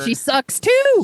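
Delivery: high, slow mocking voice; high mock disgust. Real, not acted. Reacting to Nancy Reagan.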